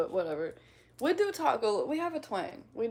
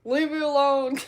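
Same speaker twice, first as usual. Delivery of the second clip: loud, higher mock complaint; playful yelled protest; 5 semitones higher, 11 dB louder than usual. Streamer teased over saying railroad, playful yelled protest. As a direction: cry out in mock hurt, about to laugh.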